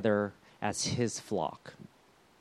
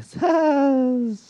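laugh; 11 semitones higher, 4 dB louder than usual. Real, not acted. Joke about being young.

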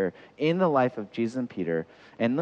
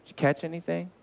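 gentle question; 5 semitones higher than usual. Acted. Jesus asking failed Peter kindly, gentle question. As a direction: ask kindly, without any scorn. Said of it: "No, he says".

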